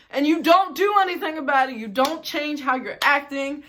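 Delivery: exasperated outburst. Real, not acted. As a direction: let it burst out in frustration. Venting at the roommate.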